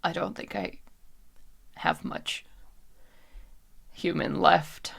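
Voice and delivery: soft, halting, half-voiced words; hushed confession. [name] with little human left, hushed halting confession. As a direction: confess it with long, faltering pauses.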